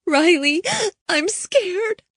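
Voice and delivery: a small voice breaking into tears; crying, scared. Acted. Girl begging Riley on the phone, crying. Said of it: "Hannah began to cry".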